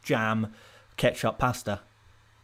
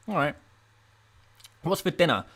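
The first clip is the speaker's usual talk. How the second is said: ordinary talk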